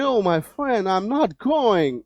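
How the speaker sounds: mock foreign English accent, vowels stretched out; put-on American-British accent. Teacher showing students the show-off English to avoid, mock foreign accent. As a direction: drawl it in an imitated Western English accent. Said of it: "इस तरीके से वाला".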